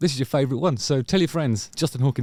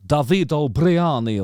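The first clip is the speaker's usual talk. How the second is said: lightly hammed-up Italian lilt; mock Italian accent; 3 semitones higher, 3 dB louder, slower than usual. Guessing the keyboardist's name, mock Italian accent. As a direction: roll out the name in playful Italian. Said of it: "like, a, an Italian name".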